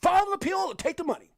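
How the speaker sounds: quoting with rising fervor; excited quote. Retold or imitated. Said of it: "So he says".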